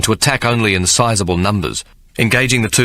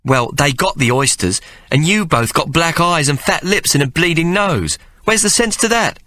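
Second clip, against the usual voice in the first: higher motherly voice, a little weary; mother Mary; 8 semitones higher than usual. Acted. Mary complaining to Ike about the boys, higher mother voice.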